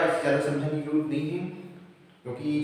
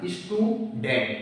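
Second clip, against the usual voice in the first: "Student" lower, as stew, then dent; split in two syllables; 5 semitones lower, 4 dB quieter, much slower than usual. Shown as a demonstration. Teacher giving another two-syllable example, "student" split in two. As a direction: break "student" into two beats. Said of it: "उच्चारण हम दो tone में कर पाते हैं".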